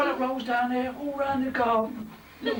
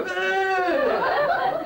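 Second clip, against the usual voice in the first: high mock-disgusted groan; posh disgusted ugh; 9 semitones higher than usual. Posh kid handed a sucked gobstopper, disgusted ugh.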